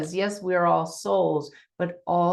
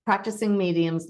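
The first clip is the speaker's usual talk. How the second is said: ordinary delivery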